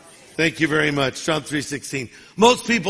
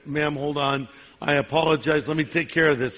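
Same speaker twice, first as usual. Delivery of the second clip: placating manager; 5 semitones lower, 4 dB quieter than usual. Acted. Manager soothes angry customer.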